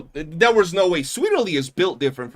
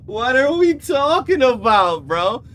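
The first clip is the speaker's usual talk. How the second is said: exasperated; 8 semitones higher, 8 dB louder, slower than usual. Real, not acted. Exasperated at ban evader.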